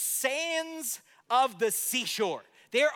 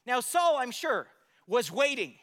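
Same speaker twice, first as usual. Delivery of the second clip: ordinary talk, nothing marked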